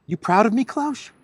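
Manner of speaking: high, loud, fishing for praise; mock needy question. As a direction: ask like a needy kid seeking approval. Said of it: "he called Klaus Schwab".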